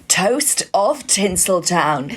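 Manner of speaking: slow, drawn-out announcer delivery; hammed-up trailer voiceover; full voiceover voice. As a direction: give it the full cheesy voiceover, word by word. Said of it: "I'm giving it full voiceover bullshit".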